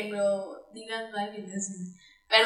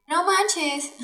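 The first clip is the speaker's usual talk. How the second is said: higher-pitched sing-out exclamation; little ringing tone; 7 semitones higher than usual. Shown as a demonstration. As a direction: imitate a spontaneous high-voiced reaction. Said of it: "ese tipo de timbrecitos".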